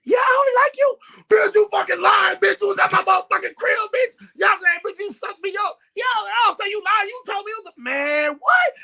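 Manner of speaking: high-pitched, heated phone tirade; acted outburst. Listener on three-way call exposes the lie, mock outrage.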